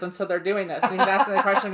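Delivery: usual speaking voice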